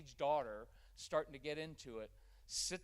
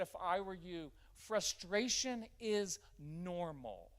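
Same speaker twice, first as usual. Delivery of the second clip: slow, even reassurance; calm validating; 4 semitones higher, 4 dB louder, much slower than usual. Shown as a demonstration. Parent gently validating an upset child, slow and calm. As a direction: say it slowly and steadily to calm him. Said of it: "I just said".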